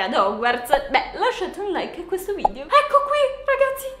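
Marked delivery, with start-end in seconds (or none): 2.6-3.9 much higher, giggly excited voice; breathless high thrill with laughs; trembling excitement. Real, not acted. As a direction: sound overjoyed holding the letter. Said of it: "mi tremano le mani".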